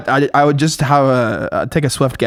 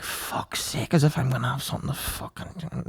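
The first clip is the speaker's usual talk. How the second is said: giving out; 2 semitones lower, 12 dB quieter than usual. Retold or imitated. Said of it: "just giving out".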